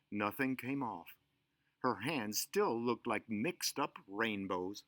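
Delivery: ordinary delivery, nothing marked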